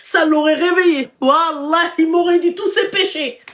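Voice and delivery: high, loud comic rant; hammed-up outburst. Host imagines slapping the caller's man, loud comic outrage. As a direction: exclaim it in laughing mock outrage.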